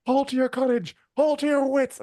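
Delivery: high-pitched Scottish rallying cry; Scottish accent. Retold or imitated. Quoting a character's battlefield speech, mock Scottish accent. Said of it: "I don't know why I'm doing a Scottish accent".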